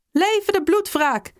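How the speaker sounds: high, drawn-out rallying shout, held back in volume; battle cry. A narrator's reading. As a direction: cry it out high and slow like a war slogan. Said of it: "schreeuwde Huib Delvoors opnieuw". (hoge, gerekte strijdkreet)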